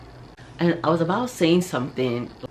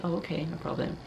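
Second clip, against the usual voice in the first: softer, compliant little reply; meekly polite; 3 semitones higher, 7 dB quieter than usual. Acted. Woman politely answering the man who interrupted, meek. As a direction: swallow the anger and agree quietly.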